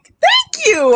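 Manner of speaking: high-pitched, grateful squeak; delighted thanks. Retold or imitated. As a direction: act out a giddy thank-you. Acting out delighted surprise at gift.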